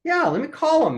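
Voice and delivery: high, bright eager offer; a retold banker jumping at the idea; pitched well up, quick to help. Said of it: "And he goes".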